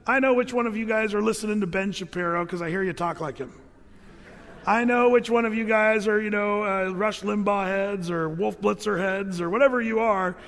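ordinary delivery

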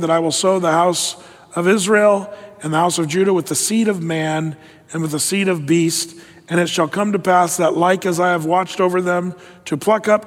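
ordinary delivery

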